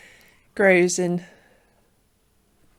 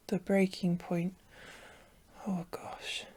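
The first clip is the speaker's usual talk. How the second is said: whispering; 7 dB quieter than usual. Real, not acted. Reacting to the episode title.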